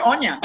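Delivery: "on ya" with a short, casual "ya"; Aussie "ya" for "you"; shortened Aussie slang. Shown as a demonstration. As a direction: clip the phrase down to "on ya". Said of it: "just shorten that to".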